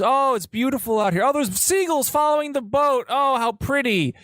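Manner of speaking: very high, gushing tourist voice; hammed-up sweet postcard voice; fake dreamy calm. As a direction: paint a lovely lie for the wife. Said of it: "told her this fantastic story".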